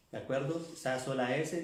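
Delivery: usual speaking voice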